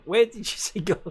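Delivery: ordinary talk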